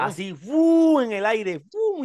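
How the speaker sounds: loud high fu whoosh; acting out the move flying through the air, loud whoosh; whoosh effect. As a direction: blow out a sharp whoosh sound. Imitating the hand drag sound.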